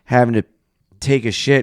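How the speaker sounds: normal talking voice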